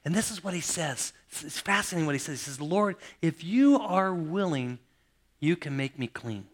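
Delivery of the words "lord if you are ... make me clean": slow plea. Retold or imitated. Leper begs Jesus.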